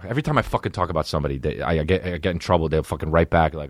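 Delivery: ordinary talk, nothing marked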